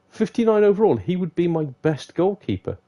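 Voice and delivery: gasp, then a high excited rush; thrilled by a cheap star keeper; excited surprise. Real, not acted. Delighted gamer spotting a bargain goalkeeper, excitedly. Gasps at a player rating.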